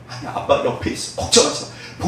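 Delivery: put-on hushed whisper to a child; soft father's whisper of reassurance; whispered comfort. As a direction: whisper it to calm him. Father soothes child in dream.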